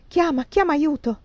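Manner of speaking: high cry for help; urgent alarm. A narrator's reading. Frightened woman urges him to call for help, urgent.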